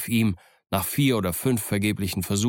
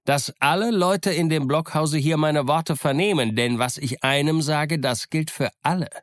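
higher pirate voice, raised so the whole blockhouse hears; 7 semitones higher, 3 dB louder than usual. Acted. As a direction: project it over the stockade. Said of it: "dabei erhob er seine Stimme".

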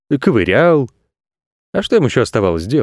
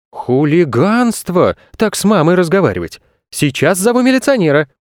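put-on higher-pitched scolding old woman; indignant; 7 semitones higher than usual. A narrator's reading. Elderly woman scolding a rude boy, indignant. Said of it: "возмутилась".

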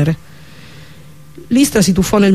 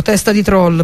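usual speaking voice

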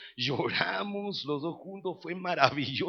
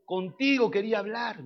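firm, higher-pitched father confronting his son; padre severo; 6 semitones higher, 4 dB louder than usual. Retold or imitated. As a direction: voice a stern dad calling his son out. Padre regañón en el relato. (padre severo que encara al hijo)

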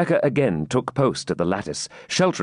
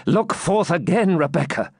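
urging knight; 8 semitones higher than usual. Acted. Wounded knight sends maiden back to window, urging. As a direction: press her gently but firmly.

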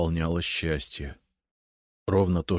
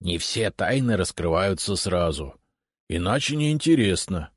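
ordinary delivery, nothing marked

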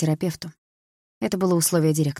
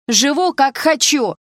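louder, higher defiant yell; yelling back; 9 semitones higher, 7 dB louder, slower than usual. Acted. Sister yanking her arm free, yelling at her brother. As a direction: shove the words at him.

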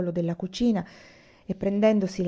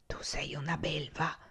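very soft, breathy murmur; 3 semitones lower, 13 dB quieter than usual. A narrator's reading. Olì reproaches Anania, barely audible murmur. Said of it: "mormorò lì".

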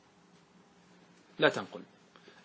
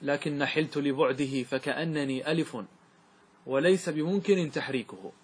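verse recited; 3 semitones lower, 4 dB quieter, slower than usual. Shown as a demonstration. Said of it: "كما قال الشاعر".

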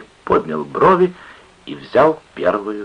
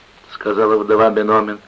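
ordinary delivery

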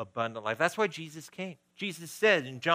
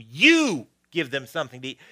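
emphatic shout; 8 dB louder than usual. Acted. Jesus shouting it at his disciples, emphatic shout. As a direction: punch "You" as a loud command. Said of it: "maybe he shouted it at them".

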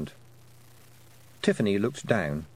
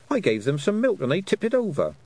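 a higher, eager girl's voice, lightly voiced; young girl Tiffany; 10 semitones higher, 3 dB louder than usual. Tiffany telling the toad her troubles, higher and eager. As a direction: sound like a flustered young girl.

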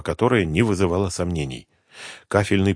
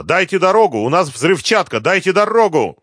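loud, high-pitched urgent shout; forceful emergency warning, voice pitched right up; 10 semitones higher, 5 dB louder than usual. A narrator's reading. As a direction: shout a crowd out of the way. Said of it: "крикнула Суслова".

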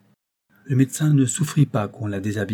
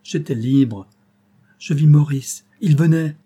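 raised, louder, exultant telling; elated account; 4 semitones higher, 6 dB louder than usual. A narrator's reading. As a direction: relive the reunion with rising fervour. Angélique relives running to her lover. (récit exalté et triomphant)

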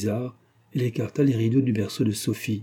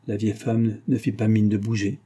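usual speaking voice

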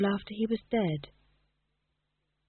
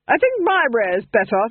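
smug duchess; 4 semitones higher, 7 dB louder than usual. Acted. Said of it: "she said".

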